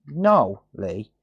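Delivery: ordinary talk, nothing marked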